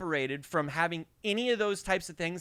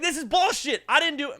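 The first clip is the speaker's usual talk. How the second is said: angry protest; 8 semitones higher, 4 dB louder than usual. Retold or imitated. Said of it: "He's like".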